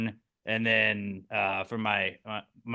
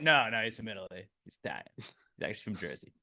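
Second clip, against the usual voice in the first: much lower, quieter lazy wiseguy; mock Italian reply; 7 semitones lower, 10 dB quieter, faster than usual. Italian guy explains his cousin to Silver Surfer, low mock voice. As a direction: shrug it off in a low mumble. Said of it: "it's just like".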